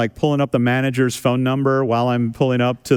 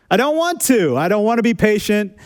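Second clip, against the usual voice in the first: high, petulant whine; whiny child; 7 semitones higher than usual. Pretend. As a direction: whine it like a stubborn kid. Mock whiny childish refusal.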